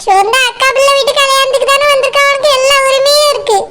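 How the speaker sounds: normal talking voice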